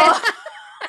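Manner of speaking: high, loud burst of real laughter. Real, not acted. Laughing at own remark. (carcajada alta y fuerte)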